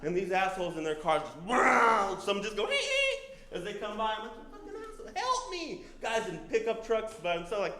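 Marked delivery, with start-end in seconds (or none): 1.5-2.2 loud, raised passing-car yell; mock heckling yell. Drivers shouting at stranded cyclist as they pass, mock heckling yell. 2.7-3.2 very high jeering "hee hee"; mocking squeal. Passing drivers jeering at the stranded cyclist, mocking squeal. 4.4-5.8 high, thin, held-back cry; mock plea for help. Stranded rider cursing cars that pass, mock plea for help.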